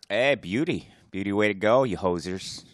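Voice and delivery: light put-on Canadian accent; mock Canadian. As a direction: play the cheery Canadian hoser. Canadian listeners bit.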